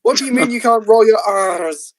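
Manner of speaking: normal talking voice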